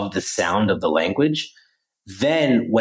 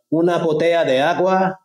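higher-pitched learner trying out new Spanish; learner asking for water; 9 semitones higher than usual. Retold or imitated. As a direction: make a tentative request in Spanish, pitched up. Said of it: "you're trying to get a water".

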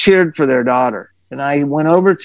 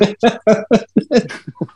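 real burst of laughter; amused laugh at the dad quote; 5 semitones higher than usual. Real, not acted.

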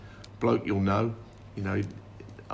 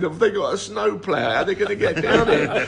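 laughing through the punchline; 10 semitones higher, 8 dB louder than usual. Retold or imitated. Retelling Jimmy's punchline, laughing.

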